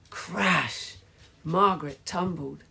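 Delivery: plain reading voice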